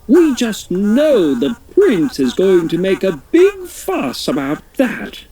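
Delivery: high, fussy royal voice; the Queen. Acted. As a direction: fret about the dented crown. Queen after crash.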